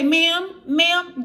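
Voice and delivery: high, loud, calling ma'am, ma'am after you. Retold or imitated. Store clerk calls after a fleeing shoplifter, high loud ma'am.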